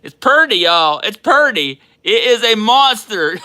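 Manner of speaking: high and loud, each word punched; excited announcement. Real, not acted. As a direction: shout the news, thrilled. Announces the rare card.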